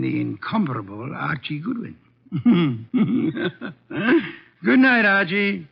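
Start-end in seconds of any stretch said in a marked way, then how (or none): 2.3-4.4 a full, rolling chuckle; hearty laugh. Acted. Wolfe teasing Archie about the pretty brunette, laughing. 4.6-5.7 amused good night. Acted. Wolfe ends with a chuckle.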